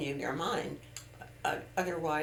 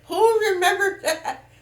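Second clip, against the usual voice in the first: high, laughing amazed question; 14 semitones higher, 9 dB louder than usual. Real, not acted. Repeats amused surprise.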